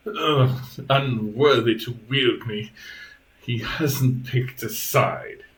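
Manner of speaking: slow, disdainful sentient blade; voice in the head. Acted. Magic blade judges the robot to its wielder, slow disdainful voice. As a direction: draw out the haughty verdict. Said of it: "the voice in your head says".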